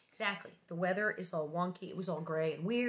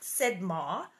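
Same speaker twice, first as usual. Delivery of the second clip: ordinary delivery, nothing marked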